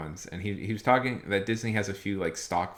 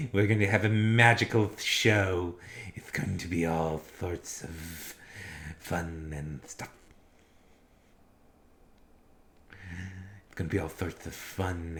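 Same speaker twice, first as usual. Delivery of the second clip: slow, drawn-out mock horror host; lightly voiced spooky showman; Vincent Price; 3 semitones lower than usual. Shown as a demonstration. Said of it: "do the Vincent Price".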